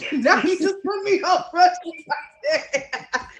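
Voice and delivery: loud, high, real laughter through the words; helpless laughing complaint; laughing protest. Real, not acted. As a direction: protest while cracking up, slowly. Laughing at being put on spot.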